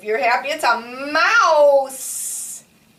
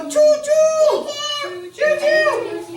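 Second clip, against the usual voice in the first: 1 train noise; 14 semitones higher, 3 dB louder, slower than usual. Acted. Train sound game with children.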